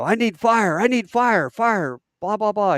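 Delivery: high, loud radio demand; urgent fire call. Retold or imitated. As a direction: call desperately for artillery. Calling artillery under attack.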